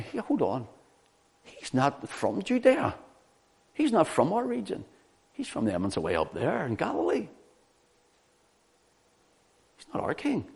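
high-pitched protest at normal volume; mock indignant objection. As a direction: object in mild outrage, pitch jumping high. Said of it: "Why did they go mad?".

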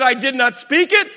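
high, loud, building to a shout; God's declaration shouted. Acted. Preacher quoting God to congregation, rising shout. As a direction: thunder God's words. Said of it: "He said".

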